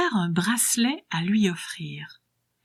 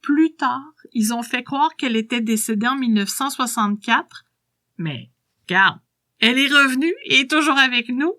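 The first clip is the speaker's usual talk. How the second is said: put-on spry old woman voice; 3 semitones higher than usual. Old woman tells her secret.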